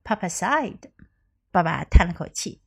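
usual speaking voice

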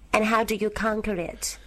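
ordinary delivery

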